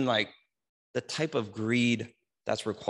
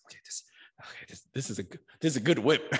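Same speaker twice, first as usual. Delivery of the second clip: whispered satisfaction; faster than usual. Retold or imitated. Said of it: "he's like".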